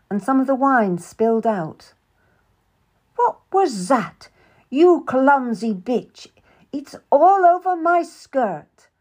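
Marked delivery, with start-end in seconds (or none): 3.1-8.9 sharp, loud, slow angry outburst, higher voice; hard and unforgiving. A narrator's reading. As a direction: snap at the clumsy maid. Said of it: "Anne's voice was hard and unforgiving".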